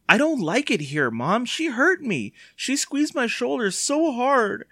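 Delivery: high-pitched whining complaint; wailing. A narrator's reading. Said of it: "Evan wailed".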